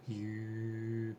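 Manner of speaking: soft demo hue. Shown as a demonstration. Counselor showing the player the hue, hushed chant. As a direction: let the hue out under the breath, gently. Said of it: "And so I shared the hue".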